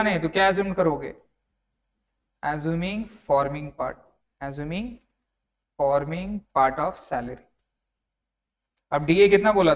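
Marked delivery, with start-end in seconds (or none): 2.4-7.4 very slow, measured dictation with spaces between words. Real, not acted. Teacher dictates an exam phrase for students to write, slow dictation. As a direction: spell it out word by word at writing speed.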